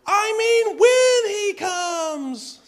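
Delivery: very high, slow, stretched-out yell; Linus yelling out. Retold or imitated. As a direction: call it out across the field, high and drawn out. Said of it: "And then he yells out to Sally".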